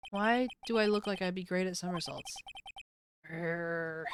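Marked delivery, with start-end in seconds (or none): 3.3-4.0 low, half-hearted growl that doesn't quite land; weak growl. Acted. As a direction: try to growl through a blocked nose. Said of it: "Pretend that I can growl better".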